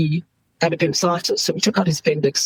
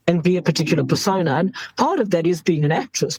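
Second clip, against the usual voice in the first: ordinary delivery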